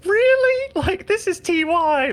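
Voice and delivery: very high, loud disbelieving squeak; incredulous. Retold or imitated. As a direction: shriek it in disbelief. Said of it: "And I was like".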